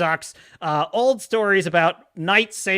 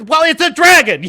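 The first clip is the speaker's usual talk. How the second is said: loud, high mocking retort; exasperated yell; 10 semitones higher, 10 dB louder than usual. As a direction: yell the obvious back at the king. Mockingly answering the shocked king.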